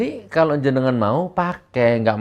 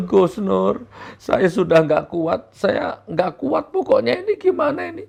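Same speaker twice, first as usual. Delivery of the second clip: mock tearful plea; 7 semitones higher than usual. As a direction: beg like a desperate follower. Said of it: "sambil nang". (jamaah mengadu sambil menangis, merengek)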